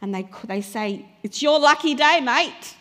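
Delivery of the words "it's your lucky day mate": loud, higher-pitched jovial shout; calling out. Acted. Crowd tells the blind beggar he is summoned, calling out. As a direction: holler the good news across.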